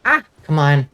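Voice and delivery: ordinary talk, nothing marked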